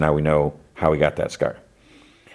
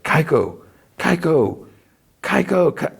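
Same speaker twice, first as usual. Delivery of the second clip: mock calling out; 11 semitones higher than usual. Young Shah searches for Kaiko, high mock calling. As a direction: call a name searchingly, pitch climbing each time. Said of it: "He's yelling Kaiko's name".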